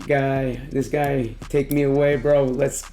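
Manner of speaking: ordinary delivery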